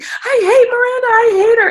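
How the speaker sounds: high, loud outraged wail; mad, near tears. Retold or imitated. Sister watching the show hates Miranda, furious high wail. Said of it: "And she was so pissed".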